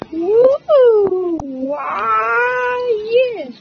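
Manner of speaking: high, loud cheering; excited cheering. Real, not acted. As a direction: whoop and cheer loudly. Cheering while gaming, then sorry.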